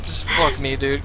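defeated mutter. Real, not acted. Defeated streamer.

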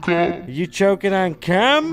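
high, loud mocking shout; loud teasing shout. Real, not acted. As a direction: yell the joke out. Teasing a friend mid-cough.

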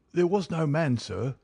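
high-pitched defiant servant; sullen defiance. A narrator's reading. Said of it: "set in sullen defiance".

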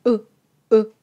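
clipped "う" with pushed-out lips; short back-of-throat u. Shown as a demonstration. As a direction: round the lips as if to whistle and cut "う" short. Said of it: "喉の奥の方から短く".

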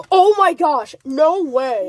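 loud, high amazed outburst; excited. Real, not acted. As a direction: blurt it out in disbelief. Pulls most expensive card.

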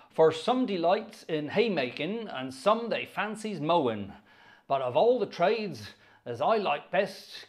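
put-on lilting rural Wiltshire accent, swinging in pitch. Organist reciting a county song about a pesky fly, Wiltshire accent. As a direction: recite the verse in broad, put-on Wiltshire dialect. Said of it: "about as good as my Wiltshire accent gets".

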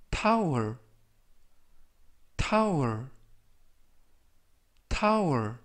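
tower said clearly three times, with pauses to repeat; careful pronunciation demo. Shown as a demonstration. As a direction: model tower for the class. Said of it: "이라고 발음하시면 되겠습니다". (tower를 또박또박 세 번 발음 시범)

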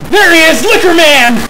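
loud, high call-out; full-volume excited yell; excited shout. Real, not acted. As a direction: holler it like announcing a champion. Calling out a sighted figure.

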